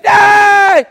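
very loud, high sustained scream; hammed-up yell of panic. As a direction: reenact a terrified coaster scream. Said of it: "I'm screaming".

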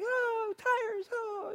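put-on high, soft girly cooing; mock helpless girls fussing over tires; imitated young girls. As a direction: put on a high, airy-headed girl voice. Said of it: "they were just like".

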